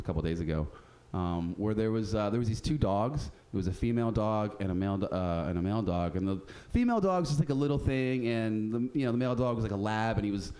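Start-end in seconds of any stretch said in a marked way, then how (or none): none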